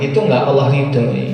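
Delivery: ordinary talk, nothing marked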